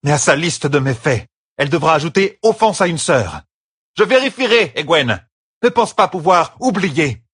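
high, loud threatening tone; angry warning. Acted. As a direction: lay down the punishment with force. Vexed sister threatens pupil with punishment.

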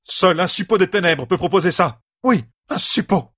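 high-pitched furious accusation; enraged. A narrator's reading. Magla accuses Moria before the hall, enraged. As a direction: hurl the accusation, hammering the repeat. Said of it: "Enragée, Magla leva le poing".

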